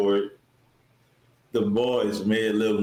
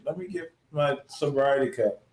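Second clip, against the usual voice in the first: usual speaking voice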